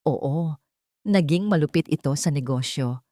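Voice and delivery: ordinary delivery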